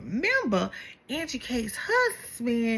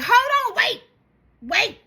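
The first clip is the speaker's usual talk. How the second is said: high, urgent hushing call; mock Meredith hushing; 9 semitones higher than usual. Meredith stops the group to point at crying Braun, high urgent hushing. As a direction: stop everyone and point her out. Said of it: "Meredith's like".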